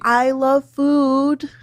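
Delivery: high, slow, drawn-out whine; I like food, sung out high and slow; whiny defiance. Real, not acted. Caller doubles down on food.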